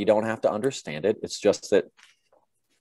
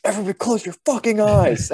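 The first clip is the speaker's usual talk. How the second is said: high, strained mock furious yell; pissed-off trainees; 13 semitones higher, 4 dB louder than usual. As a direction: imitate exhausted recruits yelling at each other, pitched way up. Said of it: "guys start getting pissed and they're like".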